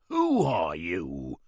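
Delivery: slow, low, a first note of fear; beginning of fear. Acted. Marley at gunpoint asking the stranger's name, slowly afraid. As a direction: let fear creep in as he asks. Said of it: "the beginning of fear in his voice".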